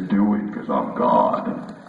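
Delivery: low, slow God voice; deep voice of God. Pretend. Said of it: "You like my deep voice?".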